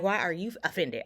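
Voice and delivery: usual speaking voice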